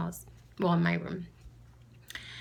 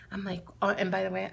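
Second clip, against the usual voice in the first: ordinary delivery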